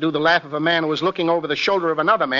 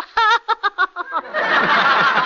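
high squawking parrot cackle; parrot laugh; 12 semitones higher than usual. Shown as a demonstration. Actor finishing his parrot demonstration, parrot laugh. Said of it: "called upon to do a parrot".

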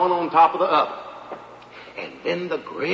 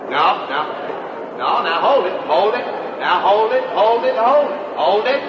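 raised voice, hands up to settle a rowdy crowd; 3 semitones higher, 3 dB louder than usual. Acted. Speaker at the UN calling a noisy assembly to order, raised voice. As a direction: hold it, hold it, over the noise.

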